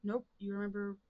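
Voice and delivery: ordinary talk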